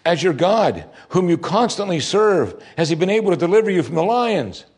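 high, troubled calling out; troubled cry. A narrator's reading. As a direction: cry out anxiously to someone below. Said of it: "cried out with a troubled voice".

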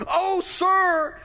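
high, loud cry of alarm; the woodcutter crying to the prophet in alarm; cried. Acted. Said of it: "he cried".

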